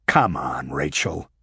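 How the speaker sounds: low-pitched interjection, exasperated; exasperated protest. A narrator's reading. Detective interrupts the angry agent, exasperated. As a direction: cut in low against the tirade.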